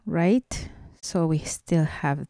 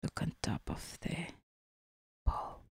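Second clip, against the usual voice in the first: whisper; 18 dB quieter, faster than usual. Real, not acted. Whispered aside to child.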